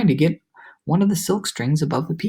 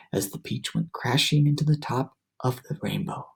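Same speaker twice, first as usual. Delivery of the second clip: ordinary delivery, nothing marked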